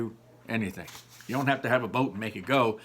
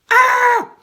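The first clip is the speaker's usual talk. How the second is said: piercing high scream, much louder; 15 dB louder than usual. Acted. Laurel and the Manticore hit by a sprite, screaming. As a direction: shriek as the sprite smacks the windshield.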